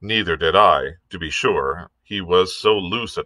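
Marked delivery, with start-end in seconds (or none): none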